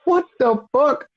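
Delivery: high-pitched mock bafflement; squeaky-high retold disbelief; Seth Rollins. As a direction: imitate an utterly thrown reaction, voice way up. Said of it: "He's literally fucked up".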